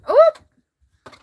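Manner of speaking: sudden high, loud "oop"; startled oop. Real, not acted. Showing viewers the cat, startled high oop. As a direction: yelp a quick, high-pitched oop.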